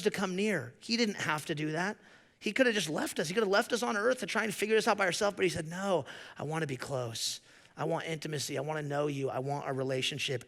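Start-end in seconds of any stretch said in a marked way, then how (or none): 5.7-10.4 gentle voice of God. Retold or imitated. Voicing God's tender reply.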